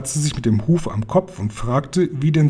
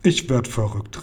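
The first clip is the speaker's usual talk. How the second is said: ordinary delivery, nothing marked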